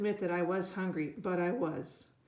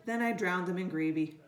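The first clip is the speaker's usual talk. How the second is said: plain reading voice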